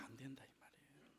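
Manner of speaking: fast hushed whisper aside; quick whisper. Real, not acted. Pastor whispering an aside to the congregation, fast and hushed.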